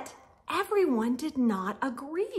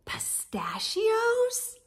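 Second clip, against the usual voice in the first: puzzled whisper; 8 semitones higher, 4 dB quieter, slower than usual. Acted. Gang puzzled by new topping.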